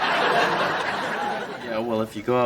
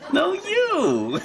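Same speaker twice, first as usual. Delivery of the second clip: giddy flirting. Acted. Couple flirting giddily.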